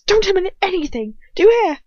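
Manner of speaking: very high, loud urgent yell; shout. A narrator's reading. Ron to Hermione, demanding she stay silent, shouting. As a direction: shout it, climbing to the final question. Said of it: "Ron shouts".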